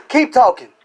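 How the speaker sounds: normal talking voice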